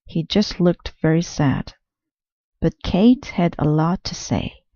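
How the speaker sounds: plain reading voice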